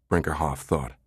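ordinary delivery, nothing marked

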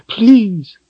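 very high anguished please; a raw, high cry. Acted. Richard crying out to Darken Rahl, high anguished please. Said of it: "Richard cried".

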